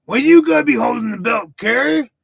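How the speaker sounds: loud, high Texas hillbilly heckle; Texas hillbilly accent. Retold or imitated. Ringside fan yelling at Kerry in a loud Texas accent. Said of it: "my Texas accent".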